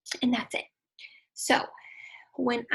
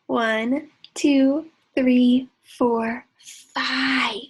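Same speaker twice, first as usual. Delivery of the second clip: loud counting; 9 dB louder, much slower than usual. Acted. Counting along loudly with kids.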